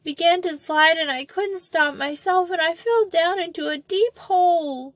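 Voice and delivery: put-on high sobbing child voice; inconsolable weeping. A narrator's reading. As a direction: sob the words out, frightened. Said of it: "came Molly's sobbing voice".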